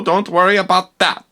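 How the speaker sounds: ordinary delivery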